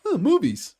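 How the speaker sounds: mock-dismissive, high-pitched quote; high little put-on scoff; Kevin Feige. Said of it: "Kevin Feige's just like".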